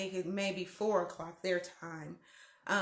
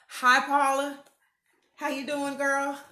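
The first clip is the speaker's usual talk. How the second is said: loud, raised hello; calling out; 6 semitones higher, 7 dB louder than usual. Real, not acted. Host greeting a neighbor who lives up the hill, calling out. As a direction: holler hi up the hill.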